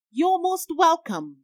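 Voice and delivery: lightly put-on English accent, high and slow; a hint of playful English accent, drawn out. A narrator's reading. Friend answering a mock curtsy in a light English accent. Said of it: "in an English accent".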